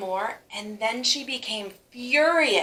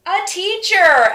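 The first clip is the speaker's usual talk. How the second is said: shouted, higher, slow; the class shouts its answer to the teacher; loud answer; 10 semitones higher, 11 dB louder, slower than usual. Acted. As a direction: call it out proudly together. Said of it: "Lily's response was the loudest".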